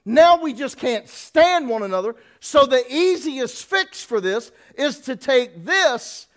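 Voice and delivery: loud, high preaching cadence, drawn out; full-force preaching. Acted. Preacher hammering at the congregation, loud and high.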